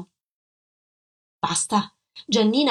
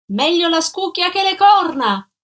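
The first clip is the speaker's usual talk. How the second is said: high, loud shouted jibe; taunting yell; 9 semitones higher, 6 dB louder than usual. A narrator's reading. Woman leaning over the railing at fleeing man, taunting shout. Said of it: "gridò lei affacciandosi alla ringhiera".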